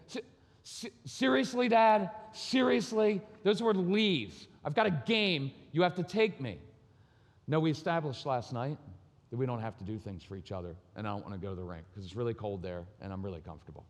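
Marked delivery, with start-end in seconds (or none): 0.1-6.6 put-on higher, pleading kid voice; whiny son. Son begging his dad to drive him to the rink, whiny. 9.3-13.9 quick, softer, lower, offhand; smug and lazy. Retold or imitated. Father teasing his son with last night's rule, smug and quick.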